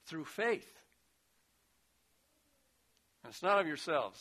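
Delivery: ordinary talk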